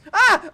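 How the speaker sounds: short, loud, high "ah"; quick cry of alarm; startled yelp. Real, not acted. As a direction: yelp as you take a tumble. Falls down in the game.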